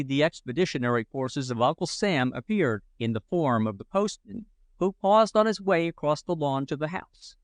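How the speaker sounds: ordinary delivery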